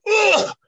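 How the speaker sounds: a sharp, high, loud strained grunt; effort grunt. Retold or imitated. Straining to lift a dead-weight opponent, with a loud grunt. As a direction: heave with everything you have.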